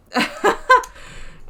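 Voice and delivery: short breathy burst of laughter; high giggling laugh; amused laugh. Real, not acted. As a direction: laugh at your own slip. Laughs at missing the cache.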